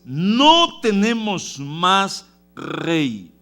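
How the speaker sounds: high, loud, slow pulpit delivery; preaching at full force; impassioned preaching. Acted. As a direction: hammer each phrase home to the congregation.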